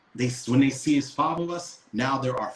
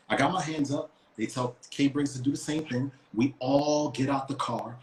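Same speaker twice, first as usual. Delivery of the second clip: ordinary talk, nothing marked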